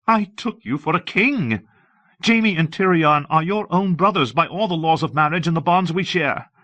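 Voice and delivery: high-pitched, scornful queen berating her husband; indignant queen. Acted.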